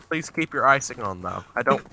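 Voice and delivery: normal talking voice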